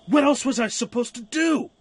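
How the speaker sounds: loud, high, indignant yell; angry shout. Acted. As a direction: throw it back at him in outrage. Quarrel in the cave.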